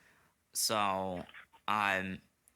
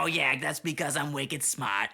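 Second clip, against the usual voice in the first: higher-pitched mock Bostonian; over-the-top Boston guy; Boston accent; 4 semitones higher, 3 dB louder than usual. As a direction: boast in a hammy Boston accent. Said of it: "smart guy from Boston".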